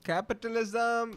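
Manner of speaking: high, drawn-out mock cry; mock exclamation. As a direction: stretch it out on one high note. Mock interjection over the video's business story. (ऊँची, खिंची हुई मज़ाकिया पुकार)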